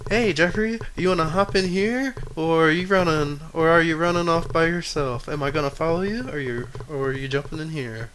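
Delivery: chirpy robot calling from her hatch; Carla the robot. Acted. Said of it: "And Carla opens up her hatch".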